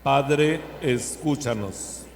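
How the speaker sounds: loud call, slow drawn-out ending; loud liturgical response. Acted. Congregation response to prayer.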